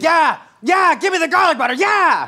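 high, loud, eager cheering; excited. Retold or imitated. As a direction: beg for it excitedly. Customers' eager reply acted out.

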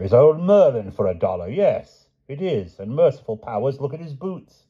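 a knight's surprised recognition; crying out, amazed. A narrator's reading. Sir Grummore recognises Merlin from afar, crying out amazed. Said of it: "cried Sir Grummore".

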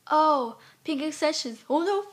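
high, loud mimicry of a critic; mocking imitation. Imitating a girl who criticized her photo, mocking. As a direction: mimic a sneering girl, pitched well up.

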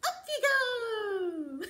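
high, loud, slow and laughing; happy swooping finale; playful up you go. Acted. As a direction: lift them up with a laugh. Finger game finale.

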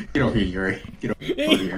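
deep monster voice. Acted. Menacing monster chase in game.

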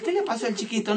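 high, sweet auntly concern; aunt's concern. Retold or imitated. Aunt Pocha asks about the tearful boy. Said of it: "la tía Pocha decía".

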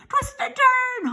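high, slow goose honk; stretched high-pitched honk. A narrator's reading. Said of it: "honked the goose".

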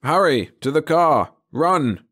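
loud, high urgent shout. A narrator's reading. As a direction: yell it over the chaos. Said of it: "he shouted".

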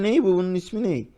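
ordinary delivery

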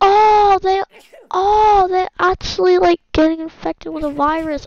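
high, loud wail of alarm; alarmed realization. Real, not acted. Player watching crowd catch virus, loud alarm. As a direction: cry out as danger dawns.

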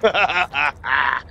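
quick staccato chuckle; real laugh. Real, not acted. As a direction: laugh in short, rapid bursts. Laughs during the game.

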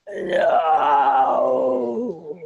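nasal, stern creature no; a firm nasal creature refusal; nasally creature voice. Acted. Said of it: "a nasally voice, Chick Chani said".

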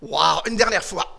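ordinary talk, nothing marked

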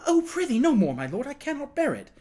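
high-pitched pleading interruption; distressed cry. A narrator's reading. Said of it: "cried Tom".

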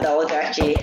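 normal talking voice

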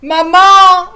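put-on high, loud child's call; appel d'enfant. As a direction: call out for mom across the house. Enfant appelle sa mère. (appel aigu et fort)

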